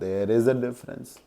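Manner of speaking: quiet echo. Shown as a demonstration.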